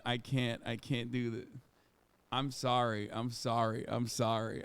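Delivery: ordinary talk, nothing marked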